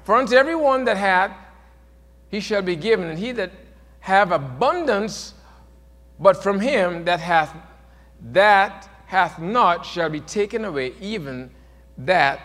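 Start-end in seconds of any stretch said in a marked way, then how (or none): none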